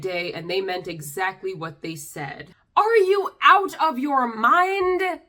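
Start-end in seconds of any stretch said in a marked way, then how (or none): 2.8-5.2 incredulous outburst. Real, not acted. Incredulous at offensive comment.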